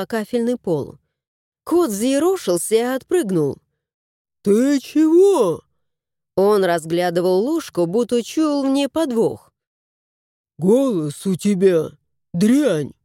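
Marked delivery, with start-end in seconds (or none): 4.4-5.6 startled cat. A narrator's reading. Cat startled by thrown spoon. 10.6-12.9 complaining house spirit. A narrator's reading. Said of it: "посетовал домовой".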